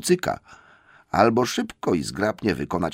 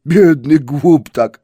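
slow troll pity; 6 semitones higher, 11 dB louder, much slower than usual. Acted. Troll William pities the hobbit, slow and loud. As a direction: drawl it like a full troll.

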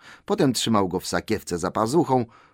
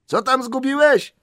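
loud, very high shouted question; calling out; 6 dB louder than usual. A narrator's reading. Bilbo asks what Gollum lost, calling loudly. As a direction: call it out to someone far away. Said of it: "zawołał Bilbo".

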